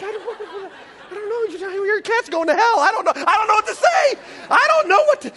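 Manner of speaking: high, loud, panicky stammering with laughs; flustered panic. Retold or imitated. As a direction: squeal helplessly like a cornered pastor. Pastor stumped by child's cat question.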